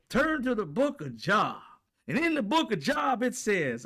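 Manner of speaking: high, loud preacher proclamation; booming pastor. Retold or imitated. Pastor reading from the book of Job, loud high preach. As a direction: preach it like you own the pulpit. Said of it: "he said".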